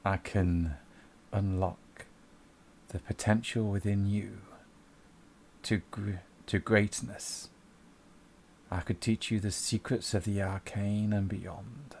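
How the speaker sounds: low, slow, hushed temptation; dark whisper. Acted. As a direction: whisper a seductive promise from the dark. GM voices the dark presence.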